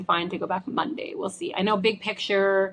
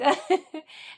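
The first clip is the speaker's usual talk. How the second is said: short laugh; 9 semitones higher than usual. Real, not acted. Laughing at sending mom photo.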